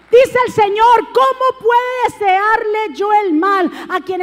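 predicando a plena voz, más alto y fuerte; exhortación encendida a la congregación; predicación. Acted. Preaching at full force. (predicador gritando con fervor)

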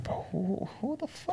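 soft, high-pitched disbelief; retold bafflement. Reacting to a stranger's hostile comment, retold quiet bafflement. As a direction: trail up into a hushed, puzzled question.

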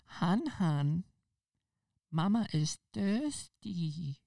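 put-on high, slow, cooing baby talk; mock baby voice. Date partner cooing baby talk to her man. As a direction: draw it out like a whiny toddler. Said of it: "people who do baby voices".

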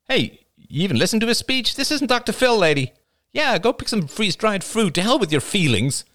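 high, strained heckling voice; angry heckler. A narrator's reading. Heckler shouts down Rachel from behind.

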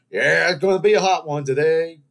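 loud, high mock weather report; weatherman voice. Pretending to report the weather on TV, hammed-up announcer voice. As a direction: play the TV weatherman. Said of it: "go up there and say".

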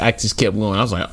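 ordinary talk, nothing marked

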